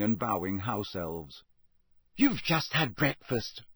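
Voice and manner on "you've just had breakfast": a touch of impatience, slow; slightly annoyed schoolgirl reproach; mildly irritated. Acted. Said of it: "said Hermione irritably".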